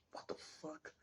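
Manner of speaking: breathless hushed whisper, almost no voice; a stunned whisper to yourself; whispered curse. Retold or imitated. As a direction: mutter it under your breath in shock. Locked in car with gunmen.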